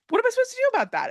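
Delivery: hammed-up helpless squeal; mock exasperation. Mock helpless reaction to screaming.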